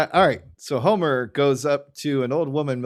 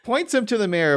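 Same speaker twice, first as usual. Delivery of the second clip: ordinary talk, nothing marked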